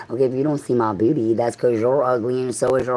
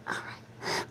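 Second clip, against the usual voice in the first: imitating Paul Mooney in a hushed whisper; 16 dB quieter than usual. Paul Mooney brushing off all of us, in a soft whisper. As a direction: breathe it out, barely any voice. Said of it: "He's like".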